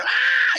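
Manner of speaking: very high imitated baby wail; sudden high mock cry. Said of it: "the baby cried louder".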